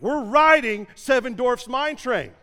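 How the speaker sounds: high, loud, slow and insistent; exasperated declaration. Retold or imitated. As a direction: announce it through gritted teeth, final word. Dad snaps back at wife.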